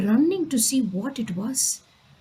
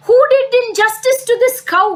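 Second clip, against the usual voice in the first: high, loud royal indignation; outraged demand; 9 semitones higher, 6 dB louder than usual. Acted. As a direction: demand the culprit as an outraged king. Shocked king demands the culprit.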